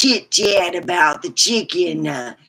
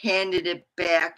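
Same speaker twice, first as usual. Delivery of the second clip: ordinary delivery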